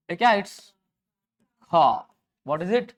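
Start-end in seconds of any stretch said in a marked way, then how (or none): 1.6-2.1 "हा" as one open long aa; low "हा" with no r after the vowel; r left unsaid. Shown as a demonstration. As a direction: end "हा" on the bare vowel. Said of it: "आर नहीं बोलना होता है".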